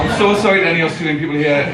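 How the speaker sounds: ordinary talk, nothing marked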